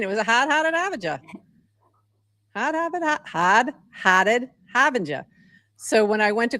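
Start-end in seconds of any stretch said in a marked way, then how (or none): 0.2-1.2 high-pitched Massachusetts accent demo; higher, r-dropping Boston-area accent; old Boston accent. Shown as a demonstration. Podcaster repeating the on-screen comment, high, in her old Massachusetts accent. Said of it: "that's how I would say that". 2.5-5.2 word-by-word Massachusetts accent demo; old Boston accent. Shown as a demonstration. Podcaster spelling out the comment word by word in her Massachusetts accent. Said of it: "that's how I would say that".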